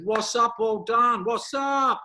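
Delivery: high, loud cheerful calling out. Retold or imitated. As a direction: sing it out proudly to the bedroom. Calls out to wife, done.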